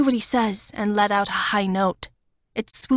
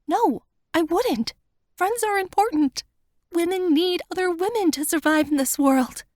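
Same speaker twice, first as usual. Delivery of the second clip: higher, tearful plea to her friend; Wanda's voice; 9 semitones higher than usual. A narrator's reading. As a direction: voice her pleading, higher and fuller. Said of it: "Wanda insists".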